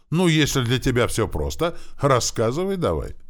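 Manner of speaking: high, loud sarcastic dare; teasing challenge. A narrator's reading. Teacher to overconfident pupil, teasing challenge. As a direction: goad him into proving it. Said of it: "заёрничал наш учитель".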